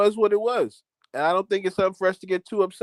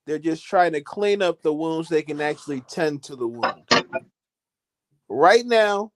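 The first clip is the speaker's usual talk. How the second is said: normal talking voice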